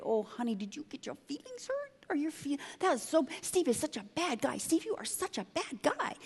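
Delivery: breathy high mock sympathy; mock coddling. Mocking churchgoers coddling Lisa, cooing softly. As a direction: coo like a fussy comforter.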